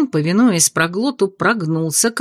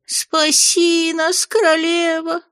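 much higher, very slow howled plea; howling plea; 10 semitones higher, slower than usual. Acted. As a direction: howl it out in despair. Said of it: "Бес ... провыл".

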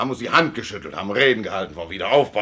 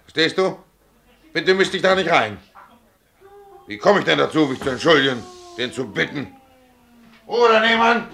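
loud, higher angry rebuff; 6 semitones higher, 6 dB louder than usual. Acted. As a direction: cut her off sharply and loudly. Mann verbietet Frau Einmischung. (laut und gereizt zur Ehefrau)

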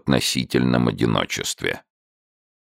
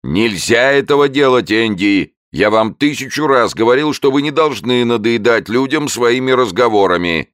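higher, louder scolding tirade; 10 semitones higher, 5 dB louder than usual. A narrator's reading. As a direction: tell the newt off sharply. Keeper scolds salamander.

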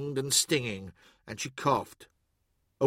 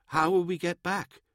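timid artificer; 4 semitones higher than usual. A narrator's reading. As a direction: ask it small and uncertain. Anxious artificer questioning a warrior.

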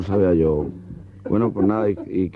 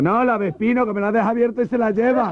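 high camp voice; camp alarm; 13 semitones higher than usual. Acted. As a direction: shriek about the stolen moped. Camp punchline about his moped.

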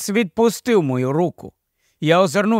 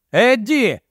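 higher-pitched cry of the name; calling out; 6 semitones higher than usual. A narrator's reading. Narrator anxiously calls after Eddie, exclaiming. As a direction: call after him, voice lifted. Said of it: "вигукнув я".

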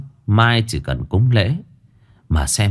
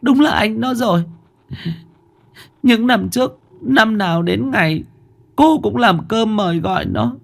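tearful mother; 13 semitones higher than usual. Acted. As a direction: confess it gently, near tears. Mother confesses to lost child.

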